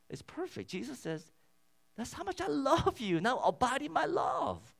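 full-force pulpit voice on love; fervent preaching. Acted. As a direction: preach with rising fervour. Passionate preaching on God's love.

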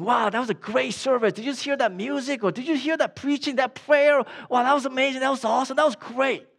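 high-pitched, excited praise; gushing churchgoers. Acted. Preacher voicing churchgoers in the foyer after service, gushing. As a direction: gush in a quick, high rush of enthusiasm.

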